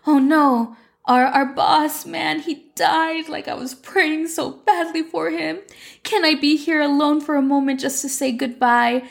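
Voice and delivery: high-pitched mock mourning; badly faked sorrow over the dead boss, voice pushed high; feigned grief. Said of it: "he feigns emotion and he's like".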